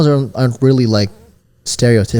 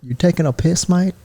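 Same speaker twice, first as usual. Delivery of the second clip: Australian accent; 6 semitones higher than usual. Shown as a demonstration. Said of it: "Or was that Australian?".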